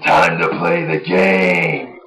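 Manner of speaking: Triple H. Retold or imitated. Triple H entrance line.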